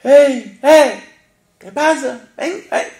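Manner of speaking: loud, high call to the other boat. Retold or imitated. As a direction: shout across the water to the other boat. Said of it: "Tuvieron que empezar a gritar". (llamada a gritos, aguda y fuerte)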